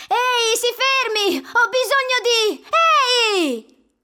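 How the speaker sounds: desperate shout. Acted. Douglas screams at the truck that won't slow down.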